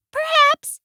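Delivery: very high, squeaky mouse voice; little mouse. A narrator's reading. Mouse caught looking for a present, high squeak. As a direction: answer as a tiny, evasive mouse. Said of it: "said Mouse".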